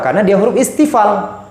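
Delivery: normal talking voice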